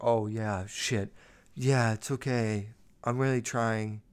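soft, subdued, putting on sadness; fake sad boy. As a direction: play the hurt kid for sympathy. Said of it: "fake this, like, sad boy".

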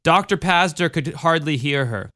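ordinary delivery

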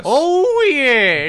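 put-on loud, high Foghorn Leghorn accent; badly faked Foghorn Leghorn drawl, way up high; put-on cartoon accent. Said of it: "What's that accent?".

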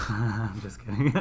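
soft laugh through the words; chuckling as you take it back. Real, not acted. Teasing joke, laughs it off.

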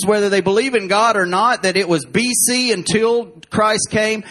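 ordinary talk

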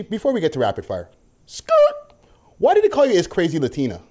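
ordinary talk

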